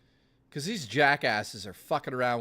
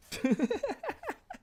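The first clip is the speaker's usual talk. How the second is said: a sudden high squeal of a laugh; high burst of laughter. Real, not acted. Streamer laughing at his own fake begging bit, high squeal.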